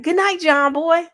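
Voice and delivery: bright sing-song bedtime answer; imitating daughter. The little girl answers her granddad, high playful call. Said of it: "And she'd be like".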